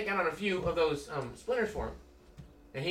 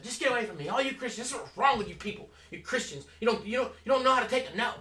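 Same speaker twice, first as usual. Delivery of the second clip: higher-pitched imitation of an irritated woman; mock exasperated scolding; 7 semitones higher than usual. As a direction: lightly act out a fed-up telling-off. Retold woman scolding the preacher.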